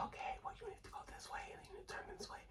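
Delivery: sneaky whispered phone directions; whispering. Retold or imitated. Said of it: "I'm whispering to him like this".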